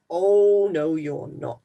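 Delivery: loud gruff "oh, no"; troll refusing. Acted. Troll refusing the little goat, loud. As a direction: boom it out like a hungry troll.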